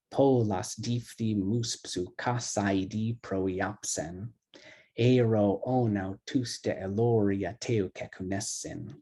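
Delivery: slow, measured chant of ancient Greek verse; slow rhythmic reading of the Iliad to soothe the class; epic poem recitation. Shown as a demonstration. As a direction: let the long-short rhythm roll like Homer. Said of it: "So let me read this".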